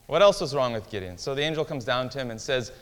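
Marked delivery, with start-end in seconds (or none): none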